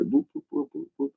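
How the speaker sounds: soft, high-pitched boops of a police siren; imitated siren whoop. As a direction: mimic a cop car's whoop, high and light. Imitates police car pulling over.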